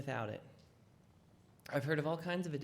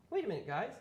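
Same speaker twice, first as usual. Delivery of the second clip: casual; 3 semitones higher, faster than usual. Pretend. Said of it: "He didn't say it like that".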